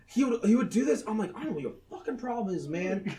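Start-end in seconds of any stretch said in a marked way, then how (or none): 1.3-3.2 fast, soft annoyed mutter; quiet mutter. Retold or imitated. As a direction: grumble it under your breath quickly. Muttering to weird cat.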